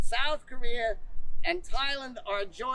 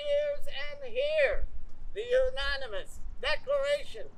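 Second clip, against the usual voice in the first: town crier; 3 semitones higher than usual. Acted. Opens the reading as crier.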